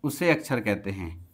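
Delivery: teacher dictating a definition to the class; slow dictation. Acted. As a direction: dictate word by word with long pauses so students can write.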